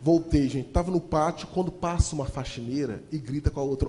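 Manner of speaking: ordinary talk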